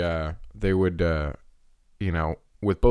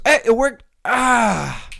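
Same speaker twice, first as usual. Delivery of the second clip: much higher, louder cheer; mock triumphant; 14 semitones higher, 5 dB louder, much slower than usual. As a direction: cheer like the trick worked. Brother after the slap.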